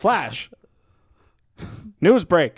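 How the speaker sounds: loud, high newsreel shout; hammed-up bulletin; mock news flash. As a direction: announce it like breaking news. Mock radio announcement.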